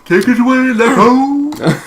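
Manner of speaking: yawning out a cheery hand-off. Real, not acted. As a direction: let a yawn carry the words. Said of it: "Don't yawn, it's contagious".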